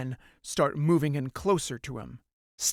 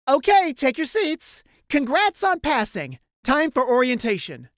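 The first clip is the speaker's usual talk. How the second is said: higher, louder brisk instructor; 8 semitones higher, 5 dB louder than usual. Acted. Instructor Colline to seated cadets, higher brisk call.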